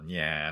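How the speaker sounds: "にゃー" with a long flattened vowel; soft, drawn-out "にゃー" between A and E; squashed "nyaa" sound. Shown as a demonstration. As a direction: stretch "にゃー" Nagoya-style. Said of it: "にゃーの音ですね".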